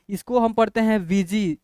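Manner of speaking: normal talking voice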